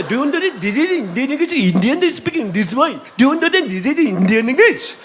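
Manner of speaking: laughing mock Indian English; hammed-up Indian accent imitation. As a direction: tease like an Indian English speaker, half laughing. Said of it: "인도 사람이 찾잖아".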